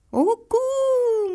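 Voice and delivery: very high cooing of the pigeon; the pigeon in the tree cooing a very high coo. A narrator's reading. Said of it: "machte die Taube oben im Baum".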